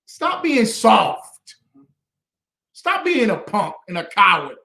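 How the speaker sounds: loud, slow, hard scolding. Real, not acted. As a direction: call them out, loud and deliberate. Scolding listeners to toughen up.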